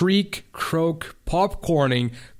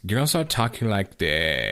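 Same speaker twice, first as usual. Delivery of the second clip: vocal fry; 4 semitones lower than usual. Shown as a demonstration. Explaining vocal fry to a co-host, creaky vocal fry. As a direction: let the last word crackle into fry. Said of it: "Vocal fry is when girls are".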